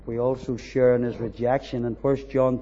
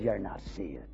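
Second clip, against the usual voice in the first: low, much softer sneering devil; devil's taunt; 7 semitones lower, 12 dB quieter than usual. Retold or imitated. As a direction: hiss the accusation quietly like a tempter. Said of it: "And the devil says".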